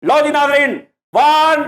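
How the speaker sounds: high, held-out count; announcer's countdown. Acted. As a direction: call out the count like a showman. Host counts down to the start. (ਉੱਚੀ ਸੁਰ ਵਿੱਚ ਗਿਣਤੀ ਦਾ ਐਲਾਨ)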